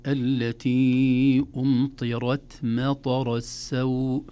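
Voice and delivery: slow, loud recitation of التي أمطرت مطر السوء; heavy ra, soft ta. Shown as a demonstration. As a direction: draw it out, dark R in أمطرت, then a light breathy final T. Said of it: "نقول".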